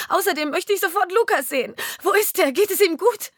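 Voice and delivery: wife yelling at husband, rising to desperate shriek; furious tirade. Acted. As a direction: scream it at him, rising to panic about her son.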